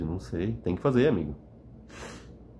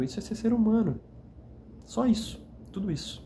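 said much higher, leaning hard on it; a sudden high, insistent restatement; emphatic high repeat; 14 semitones higher than usual. Real, not acted. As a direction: lift the repeat way up to drive it home. Repeats phrase, voice jumps up.

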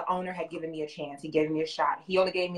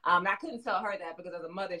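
ordinary talk, nothing marked

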